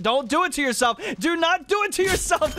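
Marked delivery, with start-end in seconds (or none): 1.2-2.5 real excited shout, pitched up high; shouted plea. Real, not acted. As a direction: Do not do it to yourself shouted much higher. Pleading with fleeing player.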